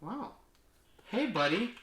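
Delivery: normal talking voice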